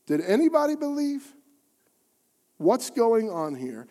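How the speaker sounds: ordinary delivery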